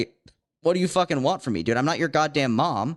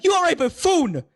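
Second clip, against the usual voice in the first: much higher, louder mocking "buffoon"; mock rude retort; 4 dB louder than usual. Pizza worker imagines mocking a dumb caller, high mock sneer. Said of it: "I literally wanna be like".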